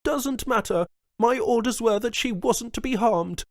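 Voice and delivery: high and loud, asserting authority; commanding governor. A narrator's reading. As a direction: lay down the law.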